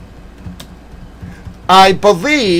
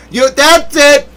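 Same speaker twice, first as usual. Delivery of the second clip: a higher "that's it", the mock anger starting; 7 semitones higher than usual. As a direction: start to lose it, in jest. Hammed-up outburst of mock anger.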